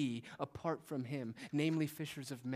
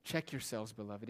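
usual speaking voice

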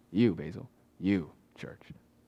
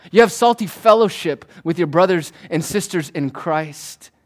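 loud, driving sermon build; full-force preaching; 5 semitones higher, 8 dB louder than usual. Acted. Pastor urging the congregation to grow saltier, full-force preaching. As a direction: preach it hard to the pews.

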